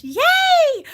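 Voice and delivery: loud, high squeal of delight; excited cheer. Real, not acted. Vlogger thrilled her viewers are getting sponsors, excited cheer. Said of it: "That makes me so excited".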